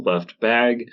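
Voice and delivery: ordinary delivery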